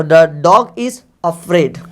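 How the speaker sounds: each word drawn out for the class; slowly, word by word, so students can follow; very slow dictation. Shown as a demonstration. Teacher reads a model sentence for students, very slowly. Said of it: "कुत्ता डरा हुआ है तो".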